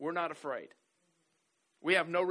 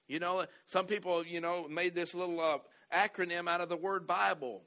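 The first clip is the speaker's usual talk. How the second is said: ordinary delivery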